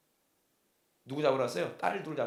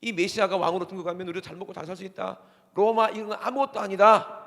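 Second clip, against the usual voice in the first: loud, higher-pitched triumphant declaration; jubilant crowd; 5 semitones higher, 9 dB louder than usual. Acted. Preacher voicing people who hail the Messiah as king, jubilant. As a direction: proclaim it like a crowd sure of victory. Voices hopeful followers of the Messiah.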